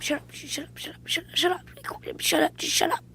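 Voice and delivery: whispered hushing; whispered shut up. Real, not acted. Hushing the heckler, whispered. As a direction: hiss it under the breath.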